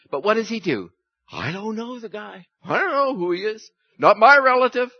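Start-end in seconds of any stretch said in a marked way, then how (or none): 1.3-4.9 higher, rising to a loud final denial; panicked denial. Acted. Peter denies knowing Jesus, building to a loud protest. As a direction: deny him faster and louder.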